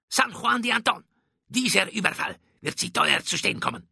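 high-pitched, affronted threat; indignant Spanish captain. A narrator's reading. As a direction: threaten your captor with wounded pride. Furious captured captain threatens Drake.

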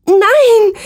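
very high, loud frantic scream; screaming like mad. Acted. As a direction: scream wildly, out of your mind. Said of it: "Ich schrie wie wahnsinnig".

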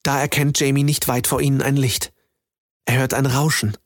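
usual speaking voice

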